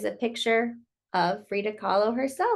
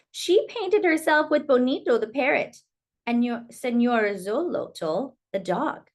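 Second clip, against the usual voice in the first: story narration. Acted. Reading Frida's story to children, lilting storyteller voice. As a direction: tell it like a bedtime picture book. Reads picture book aloud.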